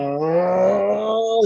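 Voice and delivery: long, high, loud "whoa"; hauling out a drawn-out, startled cry; mock alarmed whoa. Driver wrestling a big steering wheel, mock alarmed.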